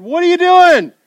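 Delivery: sudden high anguished cry; loud cry. Acted. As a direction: cry it out to weeping friends. Paul rebukes weeping friends.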